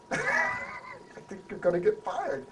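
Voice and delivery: slow drawn-out mock warning; teasing prediction. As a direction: tease him, slowly, half laughing.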